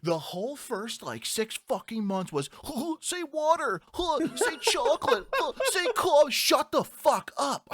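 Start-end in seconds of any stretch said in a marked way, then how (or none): 2.6-6.3 high, drawn-out goading parody; mocking classmates. Mimicking college classmates who teased his accent, high mocking goad. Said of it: "that was the normal interaction". 6.3-7.7 high, loud, drawn-out mock outburst; exasperated retort. Replaying fury at teasing classmates, loud high exasperation.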